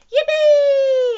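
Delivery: high, loud, drawn-out cheer; whooping high with delight; gleeful yell. A narrator's reading. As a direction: yell it out for the whole crowd. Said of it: "yelled Froggy".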